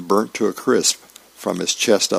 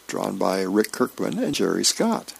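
ordinary delivery

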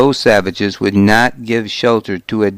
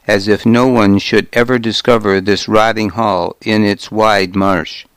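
usual speaking voice

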